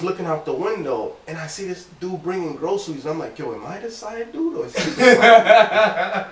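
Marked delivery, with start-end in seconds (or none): 3.4-6.3 high, laughing mock confusion, half-joking; laughing disbelief. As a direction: ask it through a laugh. Retold comic confusion, laughing.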